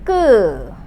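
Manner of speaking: 끄 as a tight, unaspirated kk with eu; tense Korean 끄. Shown as a demonstration. Teacher explaining the double G, tense 끄. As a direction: press 끄 out tense, with no puff of air. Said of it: "you got to pronounce it as".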